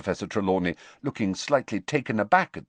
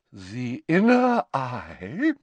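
slow, higher, grandly drawn-out words; dignified; 6 semitones higher, 4 dB louder, much slower than usual. A narrator's reading. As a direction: pronounce it with mystical self-importance. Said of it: "said Professor Trelawney with dignity".

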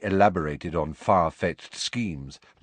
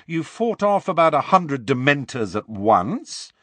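raised, higher and louder; louder still; 6 semitones higher, 4 dB louder than usual. A narrator's reading. Ron pressing Harry about his past, louder. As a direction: push it up, pressing the point. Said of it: "louder still".

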